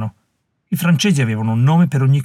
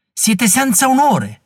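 much higher, slow outraged cry; shouting woman; 11 semitones higher, slower than usual. Acted. Young woman shouting down the warriors, outraged. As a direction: hurl the accusation at the warriors. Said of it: "gridò la giovane donna".

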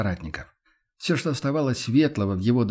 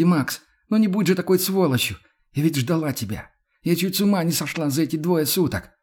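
higher, impassioned woman's voice; anguished Yana; 5 semitones higher than usual. Acted. Yana reproaches Max who doubts she exists, anguished. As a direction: plead and reproach, pitched well up. Яна в отчаянии упрекает Макса.

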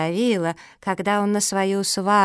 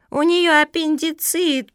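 high, loud, drawn-out alarm; panicked alarm; 8 semitones higher, 5 dB louder, slower than usual. A narrator's reading. Turtle Zoya fearing infection, panicked. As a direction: cry it out high in fright. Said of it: "перепугалась Зоя".

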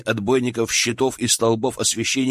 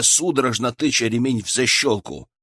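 usual speaking voice

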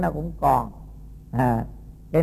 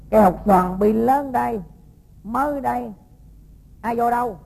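indignant accusation; 7 semitones higher than usual. Acted. The rich woman accuses the monk of stealing, indignantly raised high. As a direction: demand it like a furious lady of the house.

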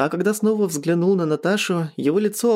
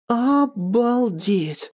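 high, drawn-out amazement; stunned sigh; 8 semitones higher, much slower than usual. A narrator's reading. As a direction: stretch the word out, dumbfounded. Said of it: "вздохнула ошарашенная Жасмин".

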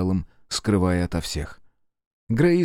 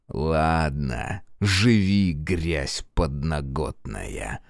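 lower, slow scornful dismissal; low contempt; 5 semitones lower, 3 dB quieter, much slower than usual. Acted. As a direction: spit the insult low. Fergus threatens.

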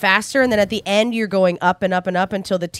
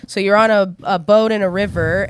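ordinary talk, nothing marked

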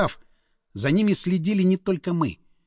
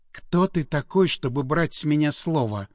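ordinary delivery

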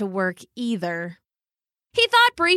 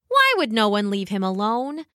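plain reading voice

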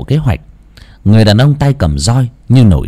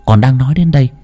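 ordinary delivery

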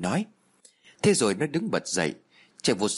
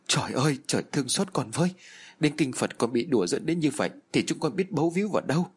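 aghast; 5 semitones higher than usual. Acted. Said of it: "Mẹ tôi thảng thốt".

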